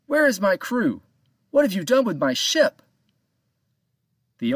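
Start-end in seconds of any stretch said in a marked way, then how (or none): 0.1-2.8 loud, high pressing questions; demanding. A narrator's reading. Captain demanding his crew and ship back, loud. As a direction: keep pushing, higher and louder. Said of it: "Captain Joe demanded".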